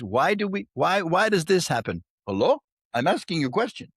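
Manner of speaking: put-on playful Indian-accented guru scolding; mock Indian accent. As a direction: tease in a light Indian accent. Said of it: "you're so good at Indian accents".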